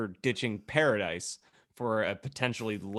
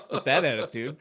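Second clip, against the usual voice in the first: laughing; 7 semitones higher, 5 dB louder than usual. Real, not acted. Laugh heard on these lines.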